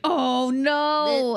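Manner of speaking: high, drawn-out caught-out reaction; mock dismay. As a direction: play a parent caught red-handed. Parent caught eating chocolate.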